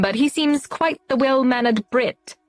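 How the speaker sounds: put-on a light, jokey stuffy British accent; lightly put-on posh British accent, teasing. Friend mocks the new renter, lightly put-on British accent. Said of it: "in a stuffy British accent".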